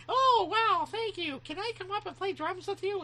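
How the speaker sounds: put-on very high, squeaky child voice; excited little kid. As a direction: gush like a thrilled seven-year-old. Retells himself as excited small kid.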